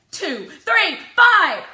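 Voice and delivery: put-on a frustrated girl shouting primes; shouting numbers. A narrator's reading. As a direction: yell them out in frustration. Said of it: "I shout".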